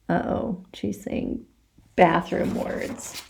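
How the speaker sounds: breathy hushed whisper, slow; whispered aside. A narrator's reading. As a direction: lean in and whisper it like a secret. Hushed aside about bathroom words.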